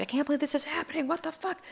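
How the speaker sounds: high, small incredulous squeak; thin high voice of mock disbelief; retold disbelief. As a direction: quote your stunned self, high and soft. Acting out her disbelief at finding cat.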